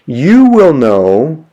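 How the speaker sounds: "you" stretched long, high and stressed; emphatic YOU. Shown as a demonstration. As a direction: slowly, lean hard on "you". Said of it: "humes is there for emphasis".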